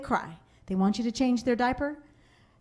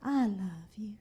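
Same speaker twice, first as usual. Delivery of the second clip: put-on soft, whispered little-girl voice; imitating daughter; 2 semitones higher, 5 dB quieter than usual. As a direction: sweet-talk Mama in a hushed child voice. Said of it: "And she goes".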